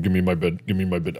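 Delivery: usual speaking voice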